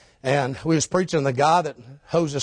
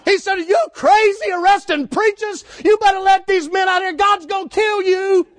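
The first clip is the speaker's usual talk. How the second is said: loud high shouted preaching; preaching at full force; 7 dB louder than usual. Acted. Preacher retelling the arrest, shouting at full force. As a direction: thunder it out from the pulpit.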